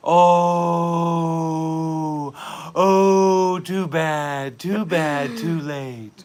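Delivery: very loud, exaggerated oh; loud hammed-up too bad; mock lament. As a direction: ham it up over the doom. Mock lament after nuke.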